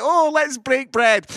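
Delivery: a touch of forced saintly cheer; saintly sweetness. Retold or imitated. Father stuck at home with family, forced loud pious cheer.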